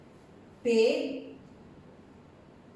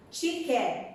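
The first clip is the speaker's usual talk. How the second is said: usual speaking voice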